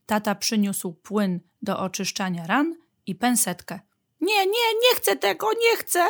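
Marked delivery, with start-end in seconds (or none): 4.2-6.0 put-on very high, protesting little kid; child crying out. A narrator's reading. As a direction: refuse in a high childish cry. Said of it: "zawołał maluch".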